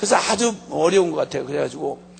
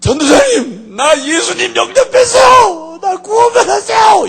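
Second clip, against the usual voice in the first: imitating a wailing convert, high and very loud; tearful shouting; 12 semitones higher, 9 dB louder, slower than usual. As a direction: cry out the news in overwrought, drawn-out shouts. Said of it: "울면서 막 소리를 지르고 들어왔는데".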